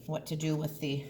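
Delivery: ordinary delivery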